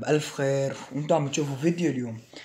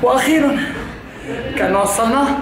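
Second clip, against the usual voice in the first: out of breath; 4 semitones higher than usual. Real, not acted. Near the top of stairs.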